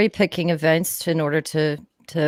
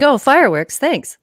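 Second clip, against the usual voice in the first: mock delight; 8 semitones higher, 5 dB louder than usual.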